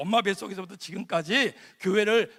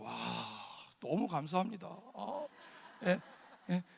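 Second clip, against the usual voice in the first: ordinary delivery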